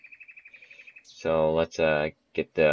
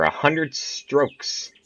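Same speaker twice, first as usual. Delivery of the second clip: slow amazed drawl; 9 semitones higher, much slower than usual. Real, not acted. Reads absurd stroke count.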